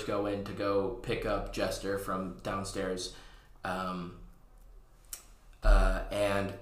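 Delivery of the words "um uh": normal talking voice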